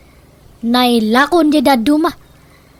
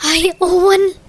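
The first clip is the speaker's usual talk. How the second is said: a short laugh breaking through the words; 3 semitones higher, slower than usual. Acted. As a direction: laugh it off, half amused. Mother reacts to son's suggestion. (agkatkatawa a sumungbat)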